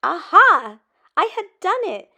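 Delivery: loud, high joyful cry; bursting with triumph; triumphant shout. A narrator's reading. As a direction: shout the victory. Triumphant moment.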